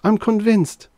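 high, loud, startled gasp. A narrator's reading. Reggie rattled by the invisible agent, gasping. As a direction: blurt it in shock. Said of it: "he gasped".

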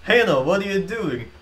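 half-laughing mock plea; mock panic. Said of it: "he's like".

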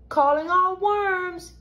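high, drawn-out summons; calling out. A narrator's reading. Peter summoning worms to his racetrack, high slow call. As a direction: call it out like a playful announcement. Peter makes a mock announcement.

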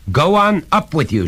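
high, loud command; father's shout. A narrator's reading. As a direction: order the boy off sharply. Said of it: "shouted his father".

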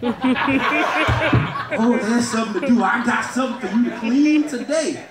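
a playful, suggestive wife, laughing; flirty wife voice. Acted. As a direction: tease him with the offer. Wife's flirty reply voiced.

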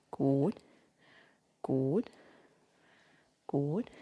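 low, soft good with a rising lilt; calm steady encouragement; soft low praise. Real, not acted. Praising dog during stay.

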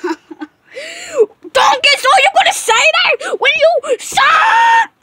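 high, loud screamed tirade; angry screaming. Pretend. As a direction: shriek it in a furious fit, drawn out. Customer loses temper at worker.

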